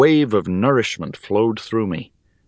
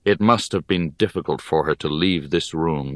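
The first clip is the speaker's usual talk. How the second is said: usual speaking voice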